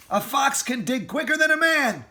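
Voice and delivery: high, loud triumphant call; rally the family; confident shout. Acted. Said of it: "shouted Mister Fox".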